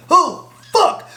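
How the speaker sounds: high startled "Oh, fuck"; imitating an awkward friend's high yelp. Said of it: "he's like".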